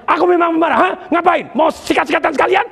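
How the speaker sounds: loud, high mock-angry outburst; hot-tempered person. Angry person answering back, acted loud and high. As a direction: play a short-tempered person spoiling for a fight. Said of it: "ketika ada orang pemarah".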